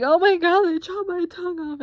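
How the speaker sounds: loud, high panicked wail; mock freak-out. Patient thinking the gauze is their tongue, mock hysterical. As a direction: act out hysterical panic, pitch shooting up. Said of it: "start crying and freaking out".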